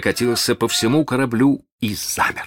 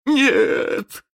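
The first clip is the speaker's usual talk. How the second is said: wail. Acted. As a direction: wail it in terror. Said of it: "завопил он".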